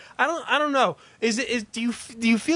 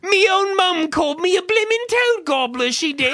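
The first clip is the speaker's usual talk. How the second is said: high, hammed-up British caricature; mock British accent; 12 semitones higher, 4 dB louder than usual. Parodying how English people say "me", mock British accent. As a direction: wail it like a cartoon Brit.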